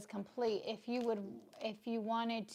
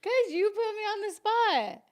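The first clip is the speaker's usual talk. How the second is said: loud, high-pitched comeback; heated retort; 11 semitones higher, 11 dB louder than usual. Real, not acted. Partner answers why they treat them so, heated retort. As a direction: fire it back at full volume. Firing back in playful quarrel.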